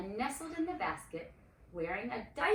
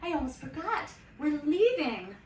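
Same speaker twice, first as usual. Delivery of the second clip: higher, louder rushed reminder; excited outburst; 7 semitones higher, 9 dB louder than usual. A narrator's reading. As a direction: burst out with the news. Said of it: "Miriam cried".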